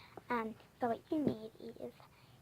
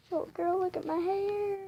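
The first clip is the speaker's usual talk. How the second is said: upset; 9 semitones higher, 6 dB louder than usual. Real, not acted. Complaining about short haircut.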